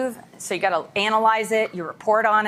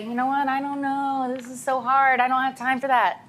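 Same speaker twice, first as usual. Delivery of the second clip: mock whine, a bit higher; hammed-up complaining voice; whiny staffer; 4 semitones higher than usual. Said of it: "gonna whine and say".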